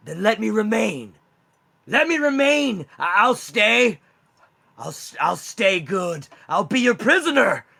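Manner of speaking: high, loud, desperate wendigo begging; a cornered wendigo pleading with August to spare him; pleading monster. Acted. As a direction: beg for your life, voice climbing in panic. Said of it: "he looks down and goes".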